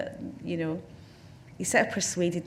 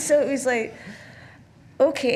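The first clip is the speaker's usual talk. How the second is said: ordinary talk, nothing marked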